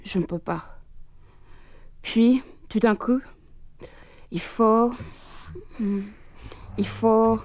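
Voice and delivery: tormented diary read in French; dramatic French reading. Acted. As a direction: read it as a haunted man's confession.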